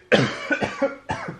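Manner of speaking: sharp high cough after swallowing the wrong way; a real cough that catches in the throat; coughing fit. Real, not acted. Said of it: "Attention, je vais tousser". (quinte de toux)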